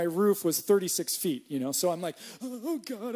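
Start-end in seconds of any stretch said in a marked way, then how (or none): 2.4-3.1 high, breathless plea while looking down; a frightened little prayer from up high; retold fright. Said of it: "I'm terrified of heights".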